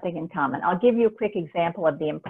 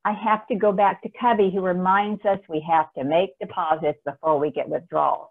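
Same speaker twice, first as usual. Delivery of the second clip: usual speaking voice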